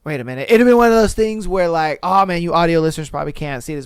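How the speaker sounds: ordinary talk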